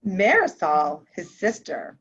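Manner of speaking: plain reading voice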